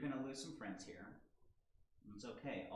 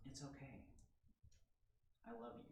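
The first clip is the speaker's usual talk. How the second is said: loving reassurance; 13 dB quieter than usual. Retold or imitated. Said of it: "just lovingly says".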